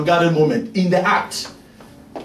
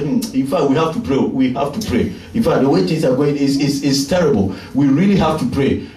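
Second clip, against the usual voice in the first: low, grumbling mock of a fretful Jesus; lightly voiced, lower and gloomy about prayer; a hint of a church-talk Jesus, lower; mock worried Jesus; 7 semitones lower than usual. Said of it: "did you ever see Jesus say that?".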